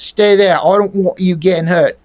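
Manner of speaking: mock London accent; British accent. Troll telling Julie to stay back, mock London accent. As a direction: redo it with dropped R's, London style.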